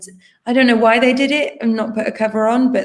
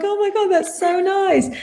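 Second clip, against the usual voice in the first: high gush; 11 semitones higher than usual. Retold or imitated. Touched reaction retold.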